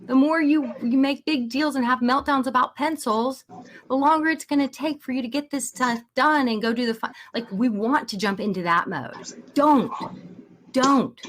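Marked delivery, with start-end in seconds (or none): 0.1-7.1 mock lecture to a kid; imitating an exasperated parent; lecturing parent. 9.5-11.2 higher, louder sharp don't; a firm warning don't. Shown as a demonstration. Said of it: "You just wanna zip it up".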